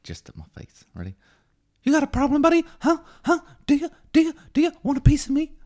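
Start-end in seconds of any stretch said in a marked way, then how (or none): 1.8-5.5 high, loud, picking a fight; squeaky tough guy spoiling for a fight; belligerent drunk. Acted. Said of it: "this is [name]'s movie impression".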